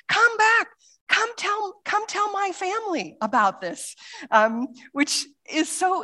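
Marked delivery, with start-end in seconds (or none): 0.1-3.9 calling after him, thrilled; eager invitation. Retold or imitated. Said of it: "And she's like".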